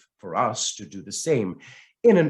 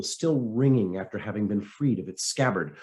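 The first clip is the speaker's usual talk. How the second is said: usual speaking voice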